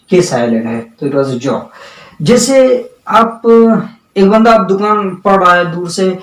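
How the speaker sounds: ordinary delivery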